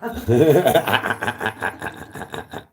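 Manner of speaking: big booming laugh; real loud laughter; loud belly laugh. Real, not acted. Laughing at own cleverness.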